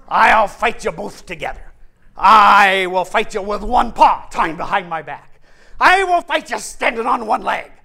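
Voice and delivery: very loud boastful character voice; blustering lion. Acted. Lion boasts he can fight them handicapped, bellowing. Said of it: "I love that scene where he says".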